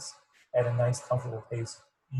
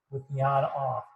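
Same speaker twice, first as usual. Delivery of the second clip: usual speaking voice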